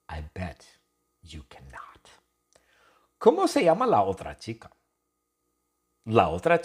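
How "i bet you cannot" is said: whispered, much softer and lower; teasing whisper. Real, not acted. Teacher daring the learners, whispered. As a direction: tease them in a whisper. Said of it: "teasing aside to learners".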